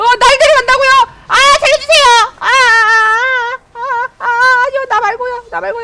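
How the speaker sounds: high, loud, hammed-up begging for mercy; a playful plea, pitched high: not me, not me; mock panicked pleading. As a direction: beg not to be taken, half joking. Being dragged off, begging.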